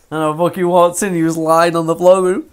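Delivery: normal talking voice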